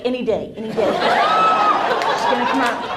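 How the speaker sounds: hammed-up fairy eyeing the tooth. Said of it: "The tooth fairy's just like".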